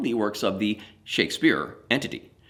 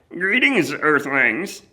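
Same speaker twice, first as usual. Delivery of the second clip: pinched high alien voice, louder; 5 semitones higher, 5 dB louder, much slower than usual. Retold or imitated. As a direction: squeeze it out like a cartoon Martian. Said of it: "the pinched voice of the Martian".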